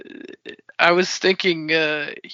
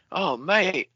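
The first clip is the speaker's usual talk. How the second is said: higher, laughing bad Australian accent, a bit Cockney; 7 semitones higher than usual. Gloating over Connect Four in a laughing bad Australian accent. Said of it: "[name] sounds like, almost like a bad Australian accent".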